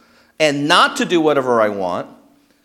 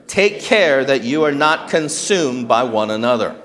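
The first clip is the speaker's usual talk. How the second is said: slightly louder, steady group recitation; unison scripture reading; 5 dB louder than usual. Real, not acted. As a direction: read out loud together, firm and clear. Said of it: "All together, one voice".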